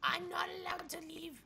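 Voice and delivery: strained breathy whisper; raspy whisper. Acted. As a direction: rasp it out in a hurt whisper. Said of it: "my voice just keeps on going worse".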